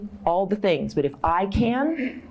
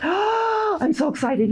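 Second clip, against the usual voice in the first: gasping, high, slow squeal of delight; mock excitement; 12 semitones higher, much slower than usual. Teasing the audience with a high gasping squeal. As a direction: fake thrill at the raised hands. Mock reaction to raised hands.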